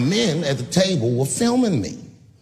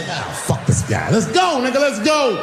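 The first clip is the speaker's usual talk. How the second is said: shouted, rising to a much higher call-out; mock fight challenge; 6 semitones higher than usual. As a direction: bark it like you're ready to brawl. Squaring up for a fight.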